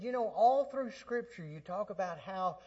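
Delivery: soft, earnest retold prayer; a hint of a whispered plea; prayer to the Lord. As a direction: pray it quietly, sincerely. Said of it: "I said".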